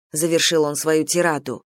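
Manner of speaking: usual speaking voice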